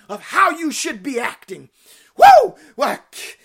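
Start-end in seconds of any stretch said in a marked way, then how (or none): 2.2-2.6 high, loud joyful whoop; excited whoop. Real, not acted. Preacher whoops at the congregation, overjoyed shout. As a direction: let a big happy shout burst out. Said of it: "Oh, I'm getting excited".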